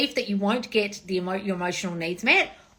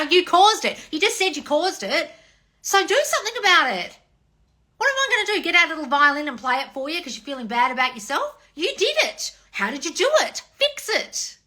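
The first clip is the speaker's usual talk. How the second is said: higher and louder, tough-love telling off; exasperated scolding; 8 semitones higher, 5 dB louder than usual. Real, not acted. To a fan feeling sorry for herself, an exasperated scolding. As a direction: scold them into fixing it.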